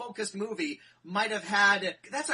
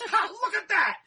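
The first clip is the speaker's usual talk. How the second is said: quick high, louder exclamation in a put-on voice; mock delighted aside; 10 semitones higher, 5 dB louder than usual. Tim Curry interrupting his space monologue, mock delight. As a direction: break off the speech to gawk at a passing asteroid. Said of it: "He stops his monologue and goes".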